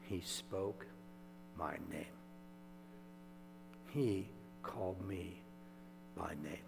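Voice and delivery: soft, slow, hushed wonder; awed whisper. Acted. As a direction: let it land softly, in awe. Mary tells of meeting Jesus.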